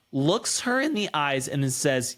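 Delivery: ordinary talk, nothing marked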